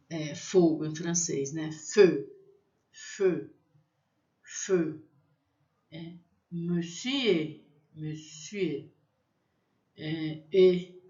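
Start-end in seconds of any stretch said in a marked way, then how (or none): 1.7-5.0 feu with a closed eu; French feu. Shown as a demonstration. Teacher drilling feu, closed vowel. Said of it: "E U tem um som fechado". 6.5-8.9 monsieur slowly, closed eu; French monsieur. Shown as a demonstration. Teacher drilling monsieur, slow French. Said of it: "E U tem um som fechado".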